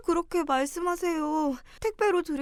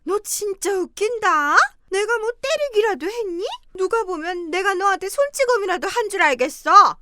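1 scornful berating; 5 semitones higher, 7 dB louder than usual. Acted. Mother-in-law berates daughter-in-law.

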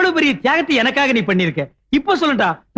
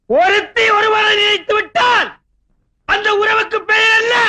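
shouting; 7 semitones higher than usual. Acted. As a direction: bellow the question so she can hear. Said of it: "நான் கத்துறது கும்பகோணம் கோபுரமே".

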